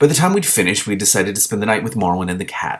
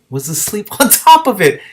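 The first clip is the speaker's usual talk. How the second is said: laughing; 11 semitones higher, 7 dB louder than usual. Real, not acted. Amused reader laughs at the cat.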